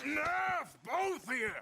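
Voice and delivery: loud, high-pitched order to stop; commanding shout. Acted. Man breaks up the kitchen quarrel, commanding shout. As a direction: shout them both down.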